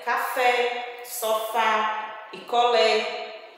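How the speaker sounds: open, stressed final vowels in "café, sofá, picolé"; open vowel sound. Shown as a demonstration. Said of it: "Tem um som aberto". ("café, sofá, picolé" com som aberto na sílaba tônica)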